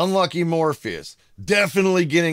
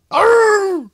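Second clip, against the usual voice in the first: mock bark; 9 dB louder than usual. Imitating the dog's bark.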